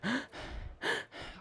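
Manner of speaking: high, airless gasp for breath; sharp gasp. Retold or imitated. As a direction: suck in air like you can't breathe. Retelling panic attack, breathless.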